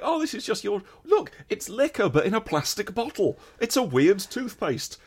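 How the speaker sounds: high-pitched tourist wonder; mock-amazed shopper. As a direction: marvel at every item in an exaggerated high voice. Acts out marvelling in a shop.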